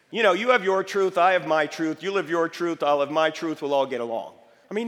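ordinary delivery

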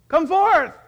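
loud, high summoning shout; commanding shout. Acted. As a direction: shout as if calling out the dead. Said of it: "He shouted".

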